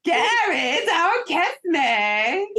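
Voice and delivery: high, very slow, whiny Irish lilt; Daisy impression. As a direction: plead coyly in an Irish accent. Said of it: "your Daisy impression is hilarious".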